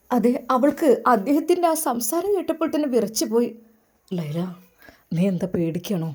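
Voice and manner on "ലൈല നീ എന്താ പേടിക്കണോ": put-on lower, gentle young man's voice; lower, tender groom talking to his bride-to-be; the groom's voice. A narrator's reading. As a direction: speak as the suitor who wins her over. Doctor on wedding night.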